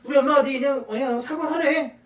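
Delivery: 높은 목소리로 삐딱하게 구는 아이; 얄미운 아이처럼 비꼬며 말해; bratty kid voice. Retold or imitated. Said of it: "제일 말 안 듣는 애". (말 안 듣는 아이를 흉내 내는 높고 얄미운 말투)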